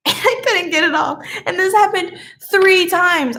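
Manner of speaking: laughing while speaking. Real, not acted. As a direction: tell it through laughter. Laughing at own mishap.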